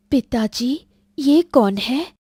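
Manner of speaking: put-on taken-aback girl asking her father; startled daughter. Daughter questions father about stranger. (चौंकी हुई बेटी की आवाज़)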